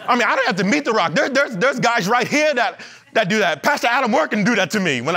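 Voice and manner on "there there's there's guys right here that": ordinary talk, nothing marked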